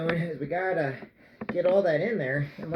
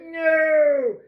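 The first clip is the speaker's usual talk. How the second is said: long, high, loud wail; mock dismayed shout; 11 semitones higher, 8 dB louder than usual. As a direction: shout it drawn-out in comic dismay. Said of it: "I'm like".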